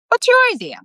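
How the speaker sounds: high squeaky cat asking. A narrator's reading. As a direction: pitch it way up as the cat. Constantine asks.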